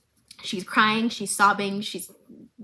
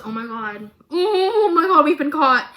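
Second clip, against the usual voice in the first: caught-in-the-act panic, rising loud; mock panic; 5 semitones higher, 6 dB louder than usual. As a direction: play the panicked kid getting caught. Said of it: "Jace goes".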